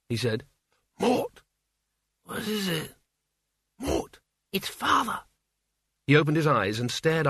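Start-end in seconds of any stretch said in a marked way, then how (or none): 1.0-1.4 high-pitched, insistent name call; urgent waking call. A narrator's reading. Isabel rousing sleepy Mort, high and urgent. 2.2-2.9 soft, half-awake question; sleepy reply. A narrator's reading. Mort woken from a dream, softly groggy. 3.8-5.2 high, slow, hushed urgency; anxious urging. A narrator's reading. Isabel waking Mort about Father, high and anxious.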